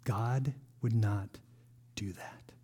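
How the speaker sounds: unvoiced trailing whisper. Real, not acted. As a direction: drop to a whisper. Whispered aside in sermon.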